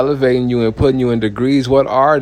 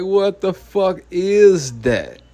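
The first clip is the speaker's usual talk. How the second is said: slow disbelief; 7 semitones higher, slower than usual. Retold or imitated. His own stunned reaction.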